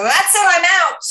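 loud, high-pitched exclamation quoting a sitcom exit; big comic declaration of walking out; Jason Alexander. Retold or imitated. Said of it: "when he was".

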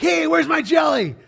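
loud, high indignant shout; flipping out. Retold or imitated. Dad demanding his missing jelly from his daughter, flipping out. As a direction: overblown outrage, voice shooting up. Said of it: "you flip out".

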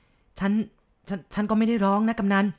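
trembling lips, tripping over words; พูดติดอ่างด้วยความกลัว; stammering in fear. Acted. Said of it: "ปากคอสั่น".

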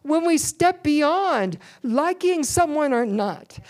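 loud, driving sermon delivery, pushing each phrase; full-force preaching. Acted. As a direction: preach it hard to the whole congregation.

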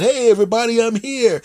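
high, loud cheery greeting; cheerful entrance. Acted. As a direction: announce yourself with glee. Fat ex-player enters.

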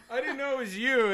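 loud, high mock surprise; excited. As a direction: blurt it out in surprise. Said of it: "I was like".

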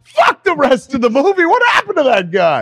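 high, loud, worked-up protest; indignant outburst. Retold or imitated. Fan yelling at the movie, indignant and loud. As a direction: demand an answer, outraged. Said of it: "I'm like".

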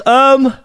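loud yawning um; a big open-mouthed yawn; real yawn mid-sentence. Real, not acted. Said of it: "Oh, now you're yawning".

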